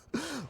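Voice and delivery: a quick, soft high laugh; short laugh. Acted. Mercenary laughs off the idea of revenge, short soft laugh. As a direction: brush off the question with a little laugh.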